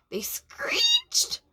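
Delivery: put-on breathy high screech of alarmed kids. Teacher voicing the kids' alarmed screech. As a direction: squeal it like startled kids.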